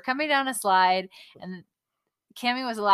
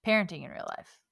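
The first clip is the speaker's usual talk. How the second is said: parenting hit first, falling after; stress on parenting; 5 dB quieter than usual. Shown as a demonstration. Wife showing the show name with stress on parenting. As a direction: punch parenting at the start. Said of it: "Emphasize the parenting".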